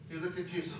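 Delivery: ordinary delivery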